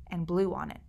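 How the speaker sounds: plain reading voice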